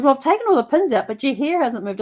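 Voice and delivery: a hint of an amazed husband, quickly; husband's remark. Husband voiced in retold story.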